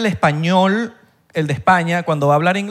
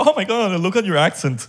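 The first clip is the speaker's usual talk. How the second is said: imitated American speaker in English; American accent; 3 semitones higher than usual. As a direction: mimic an American reacting. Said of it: "tú no ves a los americanos diciendo".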